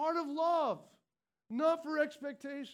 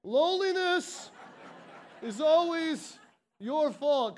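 "Loneliness is always your fault" louder and slower, each part punched; slow, loud emphasis; 3 semitones higher, 7 dB louder, slower than usual. Shown as a demonstration. Preacher restating the hard truth to the congregation, slow and loud. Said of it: "Let me say it like this".

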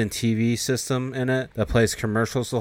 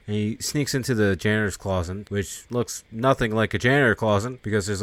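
normal talking voice

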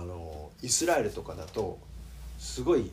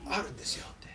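softly whispered repeat; whispered echo; 9 dB quieter, faster than usual. Shown as a demonstration. Pastor to congregation, whispered echo. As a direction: breathe the words out as an echo.

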